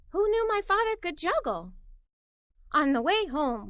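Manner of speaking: usual speaking voice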